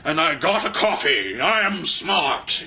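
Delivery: very slow, drawn-out mocking pretension; pretend-smart poser. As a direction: stretch every word like a self-important fool. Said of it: "they wanna pretend they're smart or something".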